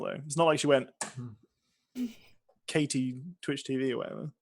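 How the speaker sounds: ordinary talk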